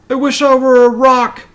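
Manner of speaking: high, loud, frantic cry; panicked wish. A narrator's reading. Sylvester, facing the hungry lion, cries out in panic. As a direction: blurt it out in panic. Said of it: "he said".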